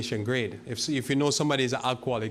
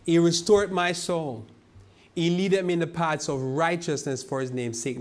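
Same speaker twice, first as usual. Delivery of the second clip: higher, louder, ringing psalm reading; scripture proclamation; 5 semitones higher, 4 dB louder than usual. Acted. Preacher proclaims Psalm 23 to the congregation, high and ringing. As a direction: declaim the verse like a sermon text.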